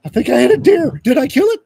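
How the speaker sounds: high, loud mock panic; panicked teenager. Teen driver asks his rescuer about the deer, high alarmed voice. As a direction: blurt it like an alarmed kid.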